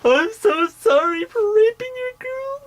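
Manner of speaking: very high squealed fake sob; mocking, over-the-top blubbering; theatrical mock crying. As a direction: wail a hammed-up apology in a squeaky cry. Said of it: "he did this theatrical performance".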